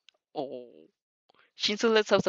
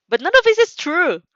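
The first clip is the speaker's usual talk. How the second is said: higher and louder, firmly denying it; insistent; 11 semitones higher, 10 dB louder than usual. Acted. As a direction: push back hard, raise your voice. Heroine insists to Shinso.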